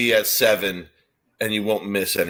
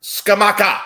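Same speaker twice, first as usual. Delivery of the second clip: much higher, louder savoured name; relishing the name; 11 semitones higher, 5 dB louder, slower than usual. Real, not acted. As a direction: roll it out with relish. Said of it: "extremely fun name to say".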